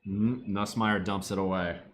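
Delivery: ordinary talk, nothing marked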